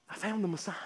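soft, awed; hushed wonder. Retold or imitated. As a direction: say it almost to yourself in disbelief. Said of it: "and I just thought".

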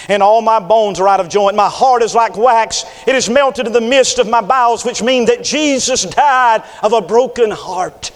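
loud, rising, fiery sermon read; preaching at full force. Acted. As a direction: thunder the scripture over the congregation.

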